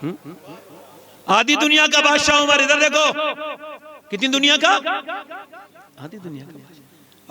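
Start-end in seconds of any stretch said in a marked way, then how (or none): 1.3-2.6 higher, louder proclamation; proclaiming. Acted. Preacher proclaims Umar's title. 6.0-7.3 much softer, lower murmured echo; hushed repeat. Acted. Repeats title under his breath.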